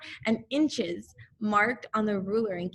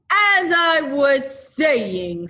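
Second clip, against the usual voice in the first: booming slow stern call to order; slow, drawn out, demanding silence; loud and stern; 6 semitones higher, 11 dB louder, much slower than usual. A narrator's reading. Eeyore silencing the interruptions, loud and stern. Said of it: "loudly and sternly".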